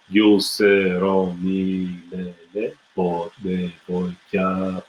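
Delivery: very slow, syllable by syllable Bulgarian lyrics; slow lyric recitation. Shown as a demonstration. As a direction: spell out each Bulgarian word slowly for students to follow. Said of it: "let's just go through the text one more time".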